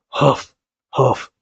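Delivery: short acted puffs of breath; acted huffing. The king arrives huffing, acted out-of-breath puffs. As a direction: puff as if out of breath.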